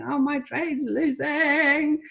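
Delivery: drawn-out, exaggerated wail; mock crying. Teacher mimicking traders who are losing, mock tearful wail. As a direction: whine it slowly like a distraught trader. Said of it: "and they're crying".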